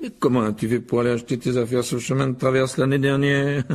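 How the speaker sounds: curious, chuckling father; intrigued by Muggle ways; amused Mr Weasley. Acted. Mr Weasley voiced. (M. Weasley amusé)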